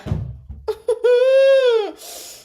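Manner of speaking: loud, high laugh-cry; high whimpering wail. Real, not acted. Reader despairing over the slip, whimpering. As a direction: wail wordlessly between laughing and crying.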